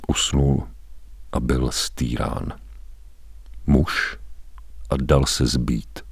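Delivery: whispered, then slow and very soft; hushed whisper. A narrator's reading. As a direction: drop to a near-whisper of shame. Shame after the beating.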